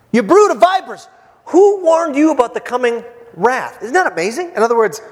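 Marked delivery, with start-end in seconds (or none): 0.1-3.8 high, loud rebuke; John the Baptist. Acted. John breaking the kind-speech rule, high loud denunciation. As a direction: hurl it at the crowd, high and loud. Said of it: "He breaks about every single rule".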